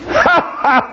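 high mocking horse laugh. Pharaoh laughing Moses off, high braying horse laugh. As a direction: bray a scornful laugh. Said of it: "give him the horse laugh".

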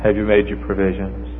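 normal talking voice

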